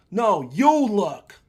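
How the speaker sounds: No, you look, said firmly in an argument; firm pushback. Acted. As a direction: push back hard and slow. Argument, pushing back hard.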